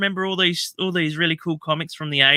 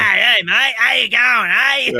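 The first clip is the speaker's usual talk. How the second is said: higher, louder hammed-up Aussie greeting; mock Australian accent; 6 semitones higher, 4 dB louder, slower than usual. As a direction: send up a cheesy Australian bloke. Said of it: "the worst Australian accent I've ever heard".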